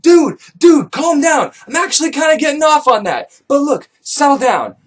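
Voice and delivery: high, loud panicked pleading; frantic calming. Retold or imitated. Narrator frantically calms Ace mid-beating. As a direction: talk him down in a hurry.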